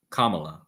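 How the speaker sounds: Kamala as KAH-muh-luh, front-stressed; her own pronunciation. Retold or imitated. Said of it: "she pronounces it".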